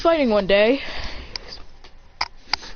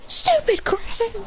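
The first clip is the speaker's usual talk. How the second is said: high, drawn-out jeer; mock scolding; 12 semitones higher, slower than usual. Classmates about to punch the boy, high drawn-out scolding. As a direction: stretch the insult out high.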